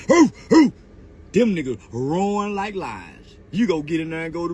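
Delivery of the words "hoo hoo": louder hoo hoo barks; mock barking. Mocking rivals' barking, loud mock hoots. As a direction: bark like a dog to mock.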